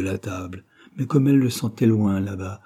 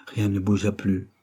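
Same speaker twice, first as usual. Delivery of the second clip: ordinary delivery, nothing marked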